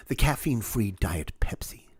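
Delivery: "The caffeine-free Diet Pepsi" whispered as if shameful; a scornful whisper; whispered disdain. Shown as a demonstration. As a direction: hush "caffeine-free" like a dirty secret. Listing decaf sodas.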